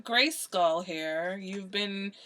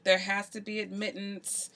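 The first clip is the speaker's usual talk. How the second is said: ordinary delivery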